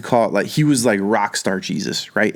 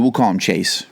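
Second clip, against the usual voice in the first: normal talking voice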